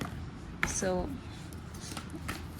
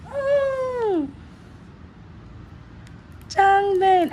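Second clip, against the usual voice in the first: high, thrilled squeal on the name; excited squeal; 12 semitones higher, much slower than usual. Real, not acted. Fan squealing after pulling her favourite's card. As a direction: shriek with delight at the card.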